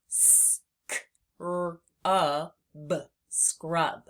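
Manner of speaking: "s, k, r, u, b" as separate sounds; sounding out. Shown as a demonstration. Teacher sounds out scrub for readers. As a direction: split "scrub" into sounds, then blend. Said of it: "we can sound that out".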